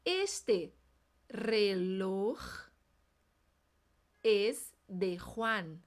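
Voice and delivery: slow, split into chunks; "Este reloj es de Juan" drawn out word by word, throat J in "Juan"; slow drill. Shown as a demonstration. Teacher drilling slowly, chunk by chunk. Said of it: "vamos treinar".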